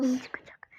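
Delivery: eerie whisper. Acted. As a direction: whisper it like a spell. Chanting, last one whispered.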